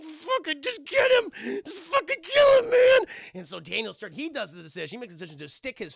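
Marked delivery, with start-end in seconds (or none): 0.0-3.0 agonized angry burglar. Retold or imitated. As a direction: scream the order through mock agony. Wounded burglar ordering partner to attack.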